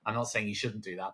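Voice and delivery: ordinary talk, nothing marked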